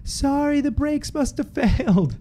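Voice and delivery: high, slow mock apology; apologetic woman. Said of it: "and she was like".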